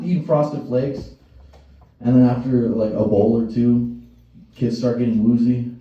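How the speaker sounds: ordinary delivery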